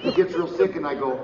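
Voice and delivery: usual speaking voice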